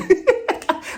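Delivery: short airy laugh; breathy amused laugh; real laugh. Real, not acted. Laughing at Robin.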